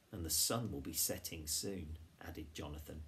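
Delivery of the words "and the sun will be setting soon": usual speaking voice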